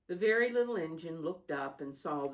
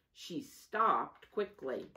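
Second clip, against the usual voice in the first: usual speaking voice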